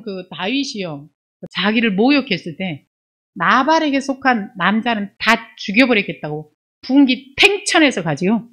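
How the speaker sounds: ordinary talk, nothing marked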